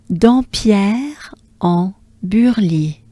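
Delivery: Dampierre en Burly slowly, in three groups; French pronunciation. Shown as a demonstration. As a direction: model the whole French name "Dampierre en Burly". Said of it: "So as a whole".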